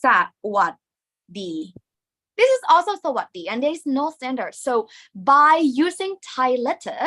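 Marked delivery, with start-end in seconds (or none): 0.0-1.5 syllable by syllable. Shown as a demonstration. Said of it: "you can also see it like this".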